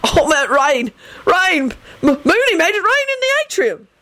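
high, stumbling cover-up; flustered babble. A narrator's reading. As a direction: babble an excuse, pitch climbing. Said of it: "Sirius babbled quickly".

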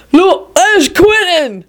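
high, drawn-out call; hollered. A narrator's reading. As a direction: holler it out to the room. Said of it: "hollered Victor".

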